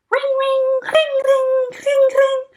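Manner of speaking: high sing-song ringing; a phone ringing in the picture book. Acted.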